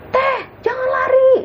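frantic calling. Retold or imitated. Rijal calling after the fleeing cousin, high loud urgent plea. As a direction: plead loudly for her not to run. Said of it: "kata Rijal gitu".

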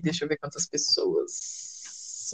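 whispered aside to herself; slow, hushed muttering. Real, not acted. Aside counting people, whispered. (sussurrando para si mesma)

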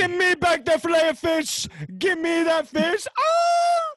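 loud, high victory yell; excited shout. Real, not acted. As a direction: shout it out in triumph. Excited shout after winning.

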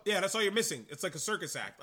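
normal talking voice

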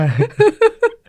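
high squeal of a laugh. Real, not acted. Laughs at Dallas detail. (หัวเราะเสียงแหลม)

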